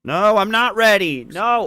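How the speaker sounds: high, loud and drawn out; whiny protest. Real, not acted. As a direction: beg not to stop. Refusing to stop playing.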